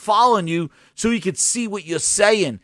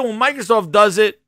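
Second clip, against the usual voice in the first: ordinary talk, nothing marked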